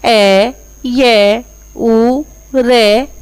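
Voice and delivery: each syllable of ए, ये, उ, रे drawn out very slowly; slow syllable spelling. Shown as a demonstration. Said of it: "कै, फिर उ". (अक्षर-अक्षर बहुत धीरे-धीरे)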